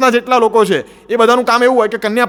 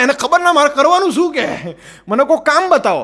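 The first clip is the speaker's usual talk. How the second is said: higher, lost groom pleading for a task; clueless groom; 6 semitones higher than usual. Retold or imitated. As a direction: play the useless groom begging for work. (કામ શોધતો મૂંઝાયેલો વરરાજા)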